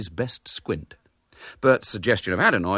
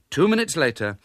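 ordinary delivery

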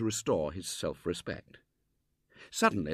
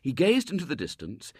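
ordinary delivery, nothing marked

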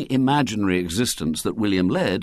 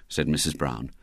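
ordinary delivery

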